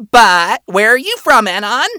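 high, innocent imp voice; innocent question. A narrator's reading. Male employee asking Anon's origin, innocent. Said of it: "he asks innocently".

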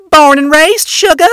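put-on high, loud, proud Wrath accent. A narrator's reading. Imp wife answering where she's from, proud Wrath accent. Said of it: "clearly a Wrath accent".

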